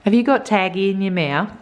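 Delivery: a mild, drawn-out mock tell-off for the little one. Real, not acted. Mother to toddler.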